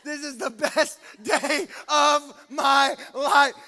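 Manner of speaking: loud, high, drawn-out mock elation; hammed-up best-day-ever holler; overjoyed exclamation. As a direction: shout it slowly word by word, thrilled. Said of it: "you're gonna be like".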